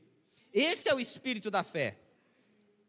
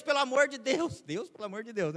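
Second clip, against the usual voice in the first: desperate pleading; 4 semitones higher than usual. Acted. Preacher mimicking a believer's prayer of despair, desperate pleading. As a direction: beg in hammed-up desperation. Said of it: "ficar desesperado pra Deus".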